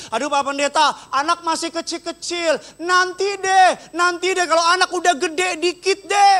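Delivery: high-pitched mock pleading to a pastor; excuse-making churchgoer. Parent putting off ministry to the pastor, high mock pleading. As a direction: whine out excuses, pitched well up. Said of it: "ngomong gini sama saya".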